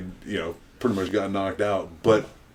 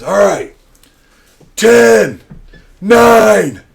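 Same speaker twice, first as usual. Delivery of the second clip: high, shouted, drawn-out count; announcer countdown; 13 semitones higher, 13 dB louder, much slower than usual. Retold or imitated. Announcer counting down to the punch, loud high shout. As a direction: call the numbers out to the crowd, each on its own. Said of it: "the announcer, goes".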